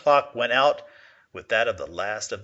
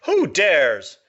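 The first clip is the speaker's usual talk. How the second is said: loud, higher hoarse demand; hoarse angry challenge; 6 semitones higher, 7 dB louder than usual. A narrator's reading. Prince to courtiers near him, loud hoarse enraged challenge. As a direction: fling it hoarsely at the courtiers in rage. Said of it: "he mocked hoarsely".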